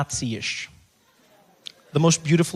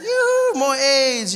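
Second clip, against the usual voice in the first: high, louder whoop for more; excited craving; 8 semitones higher, 6 dB louder, much slower than usual. Pretend. Craving another bite of chocolate, high excited whoop.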